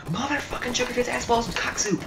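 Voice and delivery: high-pitched furious cursing; angry outburst. Real, not acted. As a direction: explode in high, loud rage. Raging after losing the lead.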